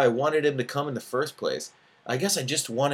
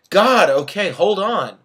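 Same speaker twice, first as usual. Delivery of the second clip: annoyed; 9 semitones higher, 11 dB louder, slower than usual. Acted. Tina annoyed on the phone.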